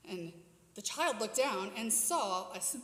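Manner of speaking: ordinary delivery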